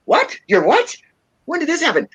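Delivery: high, incredulous mock-mom questions; yelped disbelief, retold; shocked mother. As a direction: imitate a mother who can't believe her ears. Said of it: "And she's like".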